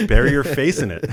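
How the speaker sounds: laughs through the words, high and loud; cracking up at the joke; real laughter breaking up the line; laughing. Real, not acted.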